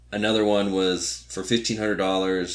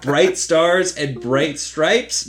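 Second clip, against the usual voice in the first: slow, loud, higher sarcastic question; mocking misquote; 8 semitones higher, 7 dB louder, much slower than usual. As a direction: drag it out sarcastically. Mocking her wrong anthem words.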